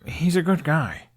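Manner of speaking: lightly voiced boy, slow and defensive; a hint of young Hiccup making excuses for his father; Hiccup to his dragon. Hiccup defends his dad to Toothless, slow and gentle.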